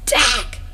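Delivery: high, loud cry of pain; sharp hurt yell; pained shout. A narrator's reading. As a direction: yelp from the knife wound. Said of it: "Breaker shouts".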